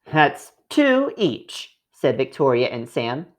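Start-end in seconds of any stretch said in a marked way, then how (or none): 0.1-1.8 put-on high, slow child voice counting it out; childlike, slowly sharing out; slow careful counting. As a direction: spell out the sum, drawn out. Children dividing cookies slowly.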